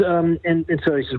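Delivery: normal talking voice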